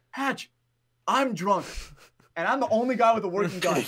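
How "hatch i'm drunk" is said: high, loud panicked teammate call; mock panicked call. Teammate shouting to Hatch mid-game, high panicked yell. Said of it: "And he's like".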